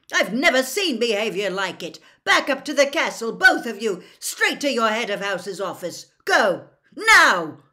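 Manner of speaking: loud, high, outraged telling-off; angry shouting, building to a blasted final word; furious shouted order. A narrator's reading. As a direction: order them off at full volume. Referee furiously ordering fighters off.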